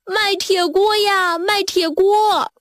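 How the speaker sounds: high, slow, drawn-out vendor call; street hawker's cry. A narrator's reading. Bear pedlar selling his last pot, slow high hawking call. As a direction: stretch out the sales call. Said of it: "又叫起来".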